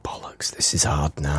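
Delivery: whispering. Pretend. Whisper game in play.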